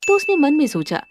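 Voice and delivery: ordinary delivery, nothing marked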